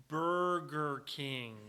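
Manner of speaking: "Burger King" stretched out syllable by syllable; exaggeratedly slow, deliberate waitress reply; very slow pronunciation. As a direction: draw out each sound of "Burger King" as if teaching it. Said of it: "pronounce where we are right now very slowly".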